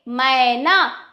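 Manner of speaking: very slow "मैना" with the ai stretched; drawn-out vowel. Shown as a demonstration. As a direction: hold the first vowel of "मैना". आई की मात्रा खींचकर दिखाना. ("मैना" में ऐ को लंबा खींचकर)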